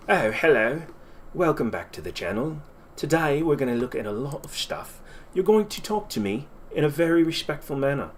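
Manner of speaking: a lightly put-on posh English voice, prim and haughty; posh English accent. Shown as a demonstration. Streamer showing chat his posh English accent, a light haughty put-on. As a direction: play a snooty posh English host with your pinky up. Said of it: "And then there's posh".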